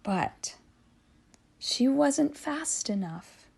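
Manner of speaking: disappointed. A narrator's reading. Narrator telling the loss, soft and sad. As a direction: let the letdown sink in.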